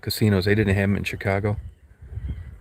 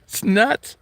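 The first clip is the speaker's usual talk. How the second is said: real laugh breaking into the words; amused laugh at how nuts gambling is; 13 semitones higher than usual. Real, not acted.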